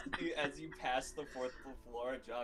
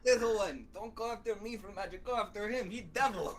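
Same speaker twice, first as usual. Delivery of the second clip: scolding NPC voice; 4 semitones higher, 7 dB louder than usual. Acted. NPC scolding player, loud.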